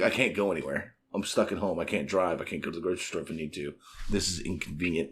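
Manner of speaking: grumbling adult. Retold or imitated. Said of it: "as an adult".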